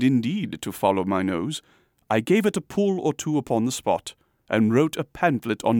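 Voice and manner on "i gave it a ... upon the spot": ordinary delivery, nothing marked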